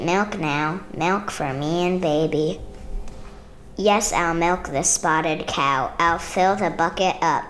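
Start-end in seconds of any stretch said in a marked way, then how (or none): none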